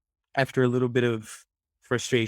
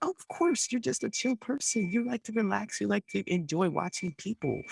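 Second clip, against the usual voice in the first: much higher and softer, sugary understanding; sweet validating friend; 10 semitones higher, 4 dB quieter than usual. Retold or imitated. A friend validating the beach-lover, high and soft. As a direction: coo reassurance at the relaxer.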